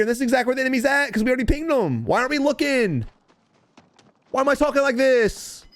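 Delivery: high-pitched exasperated rant; irritated. Real, not acted. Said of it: "I'm irritated, man. I'm irritated".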